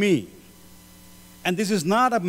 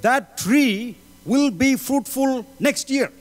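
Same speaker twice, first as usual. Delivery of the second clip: slow, raised pleading assurance; pleading promise; 5 semitones higher, slower than usual. Acted. Savior pleading before the Father, slow raised promise. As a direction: promise it slowly, earnestly. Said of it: "There is a Savior pleading for you and me, saying".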